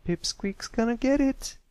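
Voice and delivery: high, sneering filly voice; mean taunt. Acted. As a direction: taunt him with spite. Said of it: "she laughed meanly".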